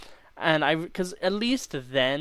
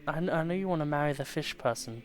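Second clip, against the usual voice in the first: usual speaking voice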